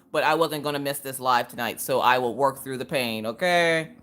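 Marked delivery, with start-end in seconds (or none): none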